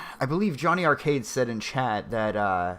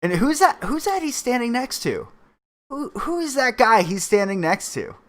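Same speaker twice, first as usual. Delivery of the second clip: surprised; 10 semitones higher, 4 dB louder than usual. Real, not acted. Curious at figure in clip.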